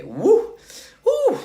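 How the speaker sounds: loud high whoop, rising on the second. Real, not acted. Speaker with salty sweat in his eyes, loud whooping. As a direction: let out sharp whoops to shake it off.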